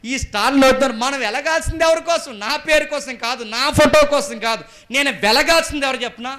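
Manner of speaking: high, loud scolding from the pulpit; loud rebuke. Real, not acted. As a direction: thunder the rebuke at the listeners. Preacher at full force. (ఎత్తైన స్వరంతో గట్టిగా మందలింపు)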